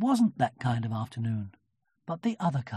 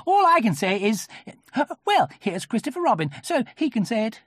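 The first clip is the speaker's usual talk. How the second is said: high, loud, self-important voice; bluster, then hand it over; Rabbit; 9 semitones higher, 5 dB louder than usual. A narrator's reading. Rabbit passing the matter to Christopher Robin, high and blustering.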